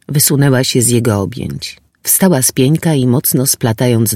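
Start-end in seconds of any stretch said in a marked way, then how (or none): none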